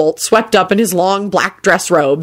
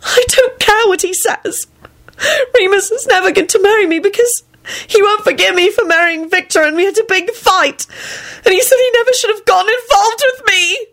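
very high, tearful outpouring; sustained high-pitched sobby wail. A narrator's reading. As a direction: bawl out the heartbreak. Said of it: "she wailed".

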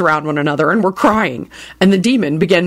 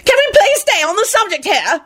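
screaming; 14 semitones higher than usual. Acted. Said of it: "Dorcas screamed".

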